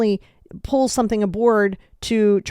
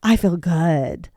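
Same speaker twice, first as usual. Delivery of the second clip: smug relief; 2 semitones lower, slower than usual. Retold or imitated. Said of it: "people stop taking their medicine".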